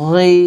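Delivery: full-mouthed heavy গই; heavy letter. Shown as a demonstration. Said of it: "মোটা করে উচ্চারণ করতে হবে".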